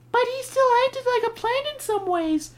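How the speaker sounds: put-on high girl's voice. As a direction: voice young Margaret up high. Margaret voiced in story.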